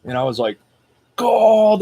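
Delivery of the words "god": adrenaline outburst. Retold or imitated. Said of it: "there goes my adrenaline surge".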